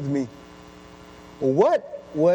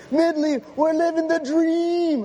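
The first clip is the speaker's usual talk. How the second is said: high, slow, stretched-out hype; a pumped friend savoring the win; hyped teammate; 11 semitones higher, slower than usual. Retold or imitated. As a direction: drawl it out like an overexcited teammate. Hyped teammate quoted in story.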